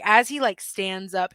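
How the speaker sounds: ordinary talk, nothing marked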